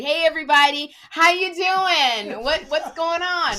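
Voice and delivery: mock my old bright club-host voice. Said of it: "that's how I talked".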